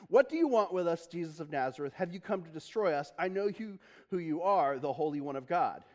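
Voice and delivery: normal talking voice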